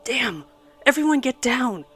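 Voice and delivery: shouting a warning as the blast hits; yelled warning. A narrator's reading. Soldier yells to his squad to take cover, loud yell. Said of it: "he yelled".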